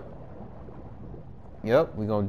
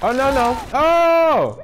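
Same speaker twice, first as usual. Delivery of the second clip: high, loud startled cry; alarmed; 8 dB louder, slower than usual. Real, not acted. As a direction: caught off guard. Character falls into water.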